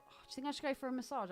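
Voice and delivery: ordinary delivery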